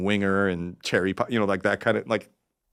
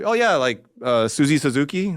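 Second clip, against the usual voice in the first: eager made-up answer; 5 semitones higher, 4 dB louder than usual. Retold or imitated. Making up fake names.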